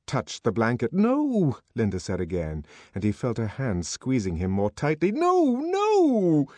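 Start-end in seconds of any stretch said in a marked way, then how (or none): 0.9-1.6 protesting. A narrator's reading. Linda protests as man approaches. 5.1-6.5 high, loud cry of refusal; raised, drawn-out plea; desperate protest. A narrator's reading. Linda resists the man, boy held.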